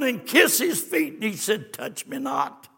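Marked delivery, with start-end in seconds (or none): 1.7-2.7 whispered, low and soft; hushed. Acted. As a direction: hold her back in a hush. Jesus gently holding Mary back.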